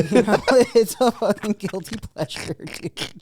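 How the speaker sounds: laughing loud and high through the words. Real, not acted. Friend confessing his guilty pleasure, laughing. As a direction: laugh it out, caught and loving it.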